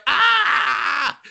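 high hammed-up shriek of terror; mock scream. Tax collectors fleeing the magic kid, mock screaming. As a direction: squeal like scared tax men. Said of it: "And they're just like".

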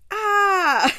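high, loud mock scream of alarm; a playful acted shriek; panicked scream. Characters panic at the dog.

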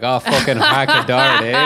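laughing, hammed-up thick Canadian accent; mock Canadian accent through a laugh. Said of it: "Sandra Bullock going like".

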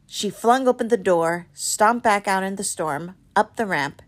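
ordinary delivery, nothing marked

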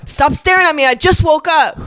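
high, grumpy mock outburst; New York attitude. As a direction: act out snapping at a starer. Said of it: "dude, that's New York, man".

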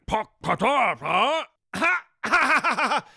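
high loud mock Klingon babble; fake Klingon gibberish. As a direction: bark nonsense Klingon syllables. Faking Klingon sounds.

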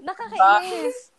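whining through laughter, pitched high; annoyed but cracking up; laugh-cry. Real, not acted. Friend complaining about Spencer's habit, laughing.

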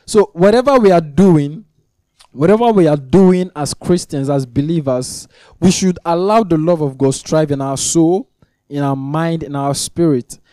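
loud, high, driving sermon voice; fervent preaching. Acted. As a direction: preach it out, rising and insistent. Preaching at full force.

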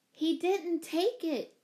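high, slow, protesting call; calling after. A narrator's reading. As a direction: call it after someone leaving. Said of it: "Jane called after her".

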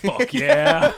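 high, loud laughing cheer; excited cheer. Retold or imitated. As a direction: whoop like a thrilled kid. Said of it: "And I'd be like".

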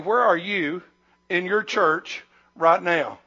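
ordinary talk

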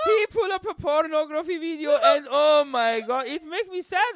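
loud, high mock lament; passive-aggressive grandfather. Grandpa complaining about the useless cousin, passive-aggressive lament. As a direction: wail it in accented, hammed-up disappointment. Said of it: "your grandfather passive aggressive".

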